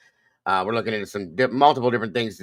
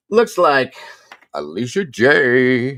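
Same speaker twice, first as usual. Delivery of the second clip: showy announcement; 4 semitones higher, 8 dB louder, slower than usual. Acted. Announcing a viewer in chat.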